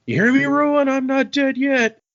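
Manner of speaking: high, loud cry of defiance; defiant shouting. Acted. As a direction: yell it wildly at an unseen enemy. Ranting god yells at Ruin.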